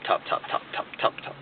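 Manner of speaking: rapid hushed whisper; whispered repetition. Real, not acted. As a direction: drop to a whisper and keep repeating. Comically piles up repeated tops.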